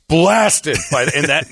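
ordinary delivery

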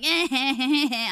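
put-on high, teasing child giggle. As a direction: giggle like a cheeky toddler. Child laughing on the escalator.

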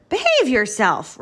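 high, loud scolding; rattling scraper. A narrator's reading. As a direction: scold like an affronted truck. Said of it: "rattled Scraper".

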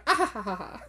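mock laugh, each "ha" voiced; written-out laugh. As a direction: act out someone's typed laughter. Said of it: "that's literally what she put".

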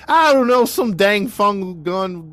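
high, loud Southern gibberish; Southern mumbling. Retold or imitated. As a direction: mumble it in a cartoonish Southern accent.

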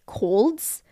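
ordinary talk, nothing marked

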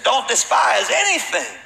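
high, loud and slow, a stern command; bishop's firm charge. Retold or imitated. Old bishop charges the worn-out pastor, loud and slow. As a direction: plant each word like an old bishop laying down the law.

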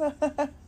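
a quick real chuckle; small laugh that breaks in; short laugh. Real, not acted. Laughing at own yawning.

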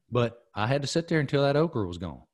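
usual speaking voice

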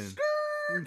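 loud "skrrr" car noise; imitated tire screech. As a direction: mimic tires peeling away. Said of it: "And then you hear".

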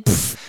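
low puff of air for flying soup; imitated splash noise. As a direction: mimic a splatter with a quick "pff".

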